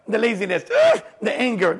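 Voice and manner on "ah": loud effortful attack cry; a burst of battle effort; fighting grunt. Acted. Teacher acting out chewing the laziness, fighting cry.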